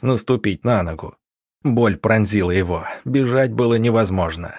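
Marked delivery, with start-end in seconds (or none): none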